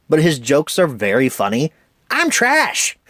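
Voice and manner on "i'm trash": high-pitched mock cry; lightly hammed-up toy catchphrase, pitched way up; Forky. Reviewer quoting a one-joke toy character, high mock cry.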